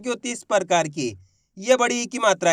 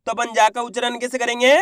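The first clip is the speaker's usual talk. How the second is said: normal talking voice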